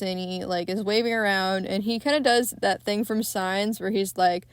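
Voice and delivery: ordinary talk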